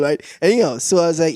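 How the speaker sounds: ordinary talk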